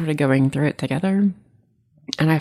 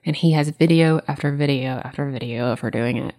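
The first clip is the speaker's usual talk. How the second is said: ordinary talk, nothing marked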